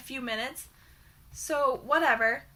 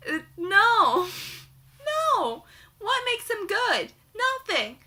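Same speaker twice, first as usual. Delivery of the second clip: indignant no; 5 semitones higher, slower than usual. Real, not acted. Indignant rejection of the hero.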